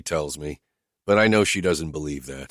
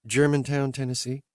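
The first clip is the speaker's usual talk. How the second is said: higher, lightly voiced woman asking bluntly; higher woman's voice, questioning her driver; Whitney's voice; 8 semitones higher than usual. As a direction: lift into her voice, direct and searching.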